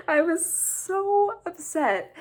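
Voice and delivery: high, slow, strained upset voice; slow, pained wail. Real, not acted. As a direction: let the voice crack with upset. Distraught retelling, vocal break.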